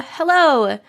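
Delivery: ordinary talk, nothing marked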